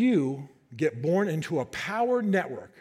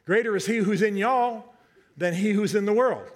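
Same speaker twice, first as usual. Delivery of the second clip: Southern accent, leaning on y'all; Southern drawl; 3 semitones higher than usual. Shown as a demonstration. Preacher going Southern for the church, Southern accent. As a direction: say it like a Georgia Southerner would. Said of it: "Let's all go Southern".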